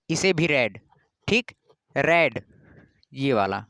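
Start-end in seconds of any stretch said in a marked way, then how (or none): 0.5-0.8 रेड said just like the colour red; short e in रेड. Shown as a demonstration. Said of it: "इसका उच्चारण अलग अलग रहेगा". 2.0-2.4 रेड pronounced like the colour red; रेड rhyming with "bed"; short e in रेड. Shown as a demonstration. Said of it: "इसका उच्चारण अलग अलग रहेगा".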